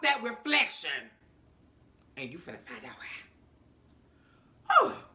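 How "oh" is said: normal talking voice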